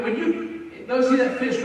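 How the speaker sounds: ordinary talk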